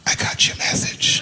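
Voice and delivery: unvoiced whisper, slow; whispered in ear. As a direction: lean in and whisper it secretly. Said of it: "He said in my ear".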